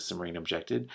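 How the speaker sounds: plain reading voice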